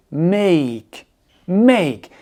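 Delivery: "Make" gliding from E to I; diphthong. Shown as a demonstration. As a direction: sound both vowels, E then I, in "Make". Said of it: "le son E et le son I".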